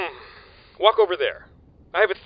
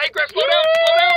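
high, loud panicked yell; panicked shout; 10 dB louder than usual. Acted. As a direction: yell to stop him. Griff speeding out of control.

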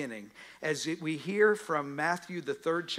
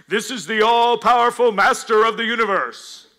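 loud, higher, grandiose proclamation; booming mock voice; 6 semitones higher, 11 dB louder than usual. The wrong heavenly voice, mock booming. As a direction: boom it like a movie god. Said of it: "No, that's not what the voice says".

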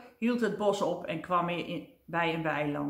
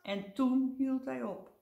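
plain reading voice

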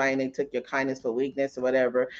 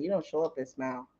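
hushed stern judge; 2 semitones lower, 9 dB quieter than usual. Retold or imitated. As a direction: quote the judge much softer. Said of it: "She said".

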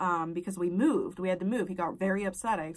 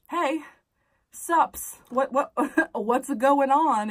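higher, louder, drawn-out "what's going on"; mock-casual confrontation; 6 semitones higher, 7 dB louder, much slower than usual. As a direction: act out confronting your husband with a laugh in it. Acting out confronting her husband.

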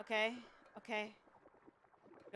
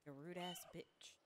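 under-breath whisper; whispered insult; 6 semitones lower, 14 dB quieter than usual. Real, not acted. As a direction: hiss it under your breath. Muttered insult at game.

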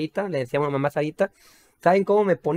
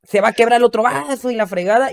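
mock uncle; 5 semitones higher, 4 dB louder than usual. Uncles' cruder version quoted.

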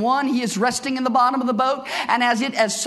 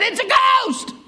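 high, startled cry of alarm; frightened cry; 10 semitones higher than usual. Retold or imitated. Disciples in the storm seeing a figure, crying out in fright. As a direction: cry out like terrified sailors in the storm. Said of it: "cried out and said".

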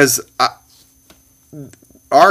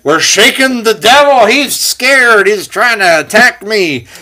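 mock dramatic; 9 semitones higher, 5 dB louder than usual. Joking about shaking the devil.

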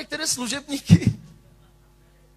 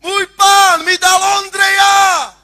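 slow, much higher and louder, rejoicing; jubilant shout; 8 semitones higher, 10 dB louder, slower than usual. Acted. Saul freed by David's playing, shouting for joy. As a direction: burst out in joy.

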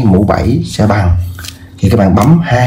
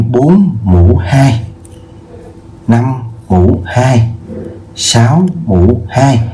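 slow read-out; much slower than usual. Shown as a demonstration. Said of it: "teacher reading examples aloud".

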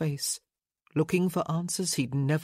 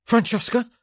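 loud, higher gasp of her name; alarmed gasp; 6 semitones higher, 8 dB louder than usual. A narrator's reading. Man running in after a scream, gasping in alarm. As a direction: burst out with it in alarm. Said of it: "he gasped".

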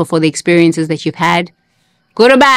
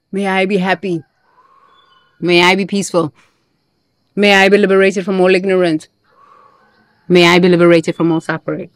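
measured, calm prayer lines; prayer recital. Acted. Showing viewers how to pray through anger, calm recital.

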